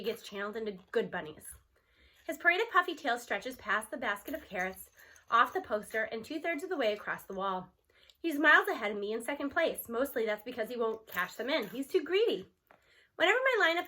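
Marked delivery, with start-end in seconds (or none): none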